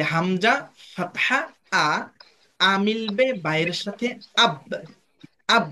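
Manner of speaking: slow, drawn-out spelling, sound by sound; slow spelling demo. Shown as a demonstration. Teacher spelling the sounds slowly for students. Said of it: "এইভাবে বানান করব".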